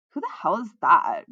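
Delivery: loud, slow grumble; grumbling. A narrator's reading. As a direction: grumble it with heavy irritation. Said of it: "grumbled Shihana".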